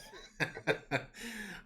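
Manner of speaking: high breaking giggle; laugh. Real, not acted. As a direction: laugh helplessly at the player's joke. Game master laughs at player's joke.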